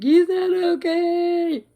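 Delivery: high, loud, drawn-out wail; hammed-up meltdown. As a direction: wail a slow, exaggerated protest. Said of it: "right in the middle of my meltdown".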